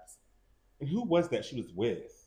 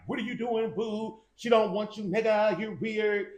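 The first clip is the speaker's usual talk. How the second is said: much higher and louder, a mocking crowd heckling him; 11 semitones higher, 4 dB louder, slower than usual. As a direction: jeer at him like the whole audience turning on him.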